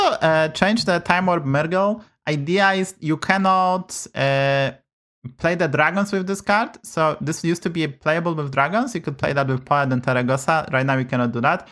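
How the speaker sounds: normal talking voice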